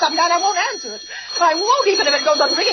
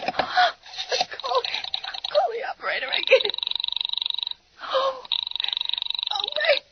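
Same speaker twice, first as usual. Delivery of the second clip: frightened hush; 8 semitones higher, 7 dB quieter, slower than usual. Acted. Frightened, nearly breathless.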